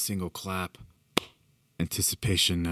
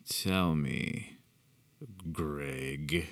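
sour distaste; 3 semitones lower, 3 dB quieter, much slower than usual. A narrator's reading. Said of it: "as if it tasted sour leaving his lips".

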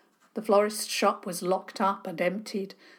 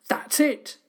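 louder and higher call across the square; 8 semitones higher, 4 dB louder than usual. A narrator's reading. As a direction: shout to point him out. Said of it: "Danny's voice called out".

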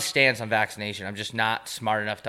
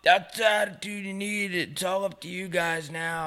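Uber driver; 8 semitones higher than usual. Retold or imitated. Uber driver approving of his young passenger, high drawn-out mimicry. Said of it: "he was like".

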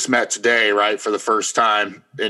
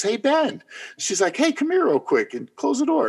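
put-on much higher, sweet older lady. Older bond-department lady calling Ben aside, high sweet voice. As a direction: call him over like a gentle older coworker. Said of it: "this older lady".

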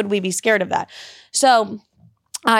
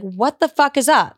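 bold greeting; 4 semitones higher than usual. Shown as a demonstration. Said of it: "Put a character on".

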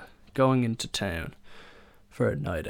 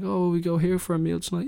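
higher, fast breezy put-on voice; breezy friend voice; 9 semitones higher, 4 dB louder, faster than usual. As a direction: toss out the idea in a light mock voice. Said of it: "And like".

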